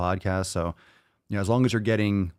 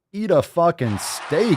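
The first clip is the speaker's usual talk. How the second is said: higher, louder, slow exasperated bark; emphatic yell; 9 semitones higher, 5 dB louder, slower than usual. Real, not acted. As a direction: blast the answer like it's obvious. Exasperated answer to listener question.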